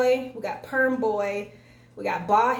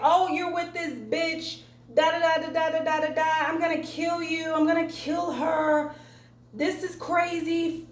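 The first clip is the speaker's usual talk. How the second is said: mock jealous ex-wife; 5 semitones higher than usual. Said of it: "his ex-wife comes out of nowhere".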